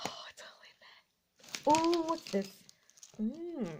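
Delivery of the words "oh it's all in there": whispering. Real, not acted. Peering into bag.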